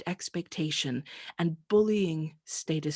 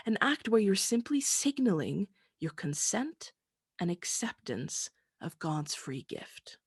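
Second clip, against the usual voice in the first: usual speaking voice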